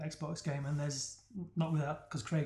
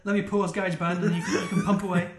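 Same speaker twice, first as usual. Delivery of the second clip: laughing shout; 3 semitones higher, 6 dB louder than usual. Real, not acted. Laughing call over banter.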